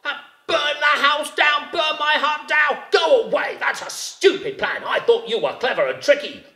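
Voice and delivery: high, shouting, outraged rabbit; indignant rabbit. A narrator's reading. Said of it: "said Rabbit".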